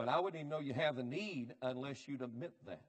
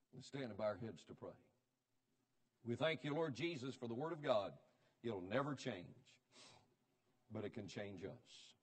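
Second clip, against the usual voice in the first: prayer; 4 semitones lower, 4 dB quieter than usual. Acted. Leads a prayer.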